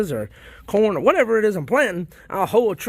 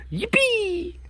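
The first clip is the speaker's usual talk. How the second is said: playful yippee; 13 semitones higher, 4 dB quieter than usual. Real, not acted. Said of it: "I like saying that word".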